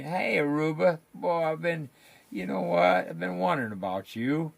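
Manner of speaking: bright burst of delight at spotting a buddy; excited greeting. Real, not acted. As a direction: call out happily to a friend you've missed. Calls out to a friend by name.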